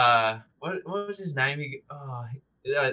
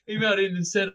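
ordinary talk

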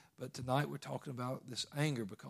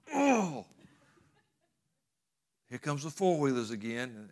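exasperated text; 6 dB louder than usual. Retold or imitated. Hunter texts his wife, fed up with neighbours' four-wheelers. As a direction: sigh it out, fed up with them again. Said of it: "I will text my wife and be like".

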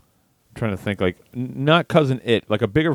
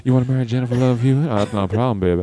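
normal talking voice

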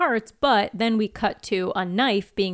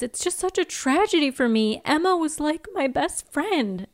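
self-pitying Cordelia; 7 semitones higher than usual. Retold or imitated. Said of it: "She says".

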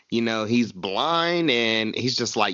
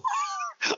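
squeaky laugh; 7 dB quieter than usual. Real, not acted. Laughing after the girls' voice.

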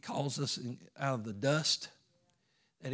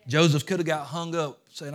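ordinary delivery

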